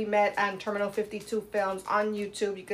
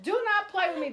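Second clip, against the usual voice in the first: Madea voice; 9 semitones higher than usual. Retold or imitated. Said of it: "Just like Madea did".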